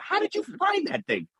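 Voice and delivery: high-pitched, incredulous question; retold amazement. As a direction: act out marvelling at a kid's find. Amazed at kid finding water towers.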